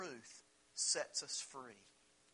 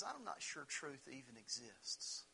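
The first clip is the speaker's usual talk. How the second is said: much softer, slow, weary doubt; jaded; 3 semitones lower, 9 dB quieter than usual. Retold or imitated. Pilate's jaded reply to Jesus: soft, slow, weary. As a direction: let it fall like a cynic who has given up. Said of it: "the jaded answer of a man".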